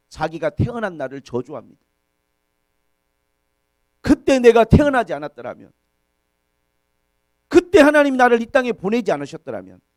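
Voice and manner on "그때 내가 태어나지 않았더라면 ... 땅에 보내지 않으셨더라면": loud, raised cry of protest; protesting to God. Retold or imitated. Job cursing his birth before God, loud protest. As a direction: hurl a louder, higher complaint at heaven. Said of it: "하나님께 항의하는 거예요".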